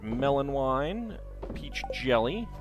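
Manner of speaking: usual speaking voice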